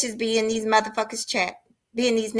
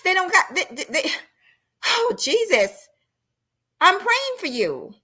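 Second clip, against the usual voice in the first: exasperated; 8 semitones higher than usual. Real, not acted. Exasperated rant.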